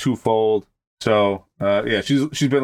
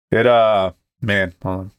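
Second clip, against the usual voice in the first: ordinary talk, nothing marked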